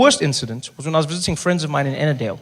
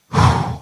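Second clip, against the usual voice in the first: heavy blown-out breath; loud exhale; stressed sigh; 4 dB louder than usual. Retold or imitated. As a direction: blow out the stress. Recalling stress of surgery.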